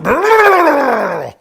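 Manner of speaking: a loud, high laughing cry of disbelief; high yelping laugh of disbelief; shocked shouted laugh. Real, not acted. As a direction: burst out in stunned laughter. Shocked outburst at the insult.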